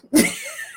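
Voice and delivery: a real, high laugh bursting out; high burst of laughter. Real, not acted. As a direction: laugh out at the punchline.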